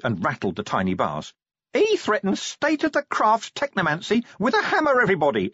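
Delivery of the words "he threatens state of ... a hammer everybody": shouting imp. Acted. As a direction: shout it to the room like a tiny tattletale. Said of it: "it shouted".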